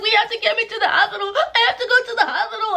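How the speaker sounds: very high mock wail; imitated screaming in pain. Begging friends for the hospital, high imitated cry of pain. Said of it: "I'm screaming in pain".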